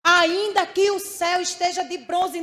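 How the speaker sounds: preacher lifting the same line into a loud cry; preaching loud. Acted. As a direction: ring it out to the church. Preacher repeats line for force.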